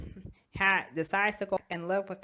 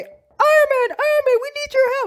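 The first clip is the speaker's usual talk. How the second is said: very high, loud pleading voice; frantic call for help; 9 dB louder than usual. Acted. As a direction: cry out urgently for the hero. Somebody at the door calls.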